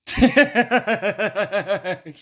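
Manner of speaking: high, falsetto burst of laughter; laughing. Real, not acted. Teller laughing at his own punchline, high and loud. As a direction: cackle high at your own joke.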